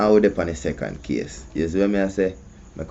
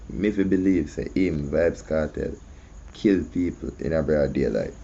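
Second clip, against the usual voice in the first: ordinary talk, nothing marked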